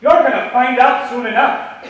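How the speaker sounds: loud, slow furious yell; a father yelling a stern warning; yelled scolding. Shown as a demonstration. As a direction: scold them at the top of your voice. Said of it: "Kalla is actually when you're getting yelled at".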